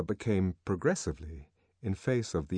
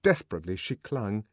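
ordinary delivery, nothing marked

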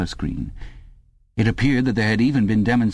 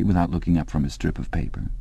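ordinary delivery

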